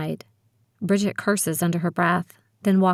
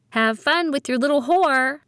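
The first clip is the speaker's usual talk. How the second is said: sing-song taunt; 8 semitones higher than usual. Acted. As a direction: taunt it in a singing lilt. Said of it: "she hollers in a sing-song voice".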